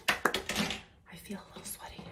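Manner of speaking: soft whispered aside; whispering. Real, not acted. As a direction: whisper it to yourself. Same words then whispered.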